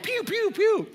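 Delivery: high little pew-pew sounds; light, high-pitched imitated shots; movie gunshot noises. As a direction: imitate cartoonish movie gunfire. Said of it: "In the movies, it's like".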